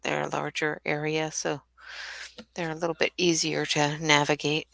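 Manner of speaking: breathless and stuffy, soft and winded; short of breath. Real, not acted. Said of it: "seem to be short of breath".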